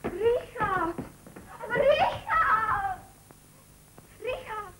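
high desperate calls of his name; calling after him. Acted. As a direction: call him back, desperate. Annie calls after leaving Richard. (verzweifeltes Nachrufen)

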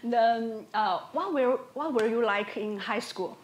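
ordinary talk, nothing marked

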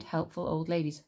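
ordinary delivery

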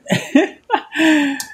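high laugh of disbelief; exasperated laugh. Real, not acted. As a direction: laugh at how hard it is. Laughs at the difficulty.

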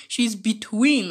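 usual speaking voice

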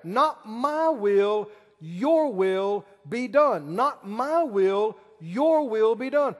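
slow, leaning on my and your; repeated prayer. Acted. Preacher repeating Jesus' garden prayer to the church, slow and weighted. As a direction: pray it over and over, slowly. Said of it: "he prayed it repeatedly".

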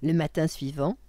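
usual speaking voice

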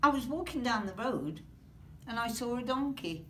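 usual speaking voice

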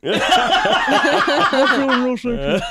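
slow, laughing mumble; laughing gagged mumble. Acted. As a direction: say it again through laughter. Repeated, slower, laughing.

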